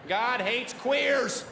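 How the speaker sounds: slow, high hammed-up protest chant. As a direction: bellow it like a picket sign slogan. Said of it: "me pretending to be a right-wing redneck".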